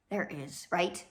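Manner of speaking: ordinary delivery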